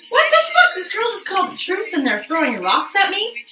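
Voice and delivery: very high indignant cry; retold shocked outburst; outraged disbelief. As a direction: react to rocks after a truce. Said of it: "I'm like".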